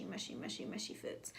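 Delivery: breathy whisper, trailing off. Acted. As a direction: whisper it like a secret. Playful emphasis on mushy food.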